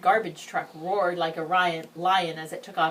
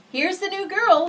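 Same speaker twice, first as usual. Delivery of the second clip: put-on loud, high boy announcing to class; loud announcement; 13 semitones higher, 10 dB louder than usual. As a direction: boom it out to the whole room. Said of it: "he announced so loudly".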